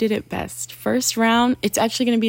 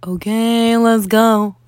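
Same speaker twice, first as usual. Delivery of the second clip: mystery voice; 6 dB louder, slower than usual. Pretend. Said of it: "mystery voice is gonna go".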